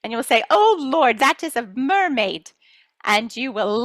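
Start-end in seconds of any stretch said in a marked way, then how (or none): 0.5-2.5 high loud gasp of wonder; high startled amazement; astonished. Pretend. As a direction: exclaim in disbelief. Amazed at mermaid.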